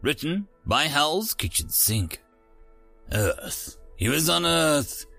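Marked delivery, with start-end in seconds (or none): none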